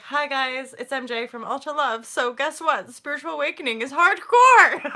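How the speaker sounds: slightly lifted, wry upbeat intro; brave face after crying. Retold or imitated. Vlogger greeting viewers right after crying, wry and upbeat. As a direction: put on a cheerful face anyway. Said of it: "literally finished crying ... put my face together".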